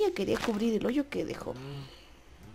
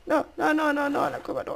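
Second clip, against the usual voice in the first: high, louder panicked no's; alarmed protest; 6 semitones higher, 3 dB louder than usual. Real, not acted. Gamer panicking as horse heads to cave. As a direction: stop it going into the cave.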